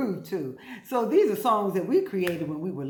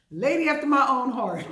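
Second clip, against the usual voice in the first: laughing through the words; 7 semitones higher, 4 dB louder than usual. Real, not acted. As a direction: chuckle as you say it, delighted. Laughing over the story.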